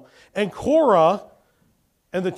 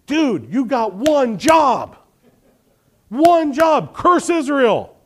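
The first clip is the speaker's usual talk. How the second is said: frustrated king; 7 semitones higher, much slower than usual. Retold or imitated. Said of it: "the king gets more frustrated with Balaam".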